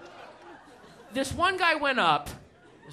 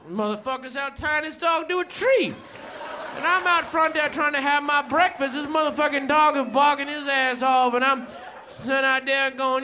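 higher, rowdy storytelling rant; ranting stand-up bit; 3 semitones higher than usual. Retold or imitated. Comedian performs the friend's set, rowdy and higher. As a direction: tear into it like an angry comic.

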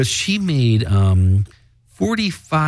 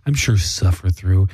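normal talking voice

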